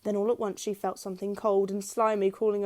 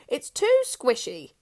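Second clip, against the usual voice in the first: mouth full; 4 semitones higher, 6 dB louder, slower than usual. A narrator's reading. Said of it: "talking with his mouth full".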